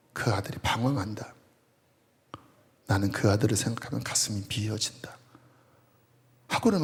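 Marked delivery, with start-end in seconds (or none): 0.1-5.2 put-on soft, sorrowful old father confiding; a quiet, sorrowful father's confession; hushed father's voice. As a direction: speak low like a grieving father. Father's confession retold in sermon.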